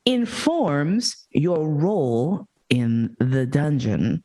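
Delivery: slow, low, emphatic exasperation; exasperated disbelief. Real, not acted. Speaker fed up with assumptions about dungeon roles, slow exasperated disbelief.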